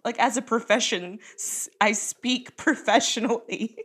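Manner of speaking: nervous. Real, not acted. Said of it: "he's making me so nervous".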